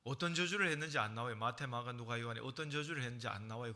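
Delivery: normal talking voice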